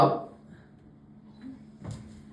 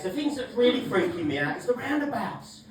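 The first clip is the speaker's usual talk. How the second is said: ordinary delivery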